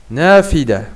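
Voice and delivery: normal talking voice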